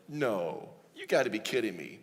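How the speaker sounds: low, soft dismissive refusal; doubtful disbelief. Acted. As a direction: play sceptical disbelief, dropping the voice. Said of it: "He doubts, he questions".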